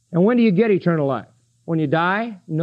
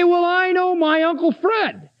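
a higher, put-on voice of a fretful congregant; doubting churchgoer; 8 semitones higher than usual. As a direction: play the doubter in the pew fretting about Uncle Fred. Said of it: "You say".

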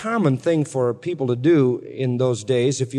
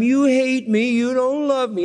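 high, mock whiny wife; whining; 8 semitones higher, slower than usual. New wife pestering Samson for the riddle, mock whine. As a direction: whine it like a dripping faucet. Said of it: "you can hear that whining deal".

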